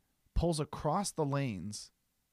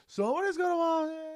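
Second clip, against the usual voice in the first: much higher, fast cartoonish put-on voice; mock drive-by line; faster than usual. Mimicking the old man driving past, high fast caricature. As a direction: fire it off in passing like a caricature. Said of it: "rolled his window down".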